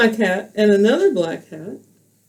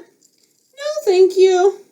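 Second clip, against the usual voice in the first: much higher small-toy bear voice; Bear's voice; 10 semitones higher, much slower than usual. Acted. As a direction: squeak a quick no. Bear declines.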